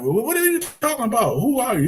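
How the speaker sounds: high-pitched mock exasperation; imitating frustrated students. Students fed up with the teacher's question, mock frustration. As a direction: throw it back as a baffled, fed-up student. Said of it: "getting all frustrated".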